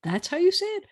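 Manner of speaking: high, incredulous "Really? That's how you say it?"; a playful, high-pitched double take; mock disbelief. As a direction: act amazed at the pronunciation. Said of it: "I'm like".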